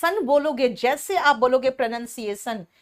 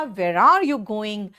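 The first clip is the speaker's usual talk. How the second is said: "Where are you going?" very slow and clear; slow model sentence; 3 semitones lower, 3 dB louder, much slower than usual. Shown as a demonstration. Teacher modelling a sentence, slow and clear. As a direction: draw out each word for students to repeat. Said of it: "जैसे मैंने कहा".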